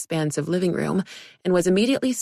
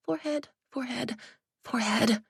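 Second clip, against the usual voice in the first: whispered mantra; 6 semitones higher, 6 dB quieter than usual. Acted. Olivia repeating her mantra.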